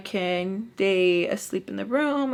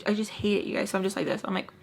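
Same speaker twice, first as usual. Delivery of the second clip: ordinary talk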